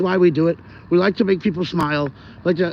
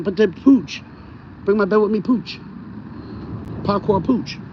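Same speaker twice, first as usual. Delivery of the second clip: imagined dog voice; 2 semitones higher, 4 dB quieter than usual. Acted. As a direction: talk for the pet poodle, mildly put-on. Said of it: "That's why I picture he talks like".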